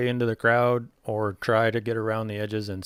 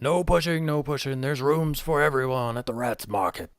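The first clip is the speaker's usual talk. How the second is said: higher goblin calling over the crowd; goblin crowd control; 4 semitones higher than usual. Acted. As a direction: hold back the crowd in the goblin voice. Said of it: "falls back into that".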